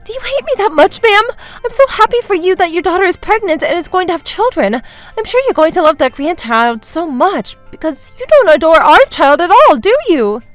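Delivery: high-pitched put-on tearfulness; fake crying. Daughter-in-law guilt-trips mother-in-law, fake crying. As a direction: plead as if hurt and weeping. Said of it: "When I started fake crying".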